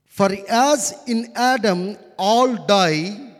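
loud, slow preacher's reading; scripture reading. Acted. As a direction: proclaim the verse slowly and forcefully. Reads scripture to congregation.

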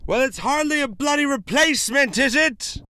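high, loud exasperated outburst; indignant sketch customer boiling over; John Cleese yelling. Acted. Customer rejecting the slug as replacement, indignant yell. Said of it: "he yells a lot".

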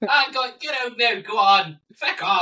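high, loud Irish priest shooing the demon away; hammed-up Irish priest yelling get out; Father Ted shouting. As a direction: bellow at the demon in a comic Irish accent. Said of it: "in a Father Ted accent".

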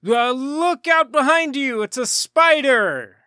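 high, slow mock warning; hammed-up fake alarm, slowly; mock alarm shout. Joking spider warning to friend.

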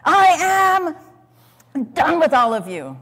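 high, loud angry cry; mock furious ruler; furious outburst. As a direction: hurl it at them in rage.

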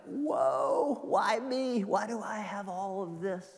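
high, wide-eyed wonder at own blessings; amazed gratitude. Retold or imitated. Grateful person marvelling at all they have. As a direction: gasp it out like someone stunned by gifts. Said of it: "from the perspective of gratitude".

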